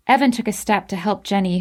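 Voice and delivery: ordinary delivery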